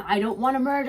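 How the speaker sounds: high, loud, desperate plea; frightened pleading. Retold or imitated. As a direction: cry out to be left as you are. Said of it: "I'm like".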